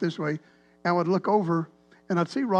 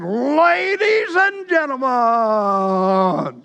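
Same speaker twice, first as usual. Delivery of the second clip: loud, high, hugely drawn-out announcer call; big game-opening announcer bellow; arena announcer; 5 semitones higher, 6 dB louder, much slower than usual. Acted. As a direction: stretch every syllable like an arena announcer. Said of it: "The announcer come on".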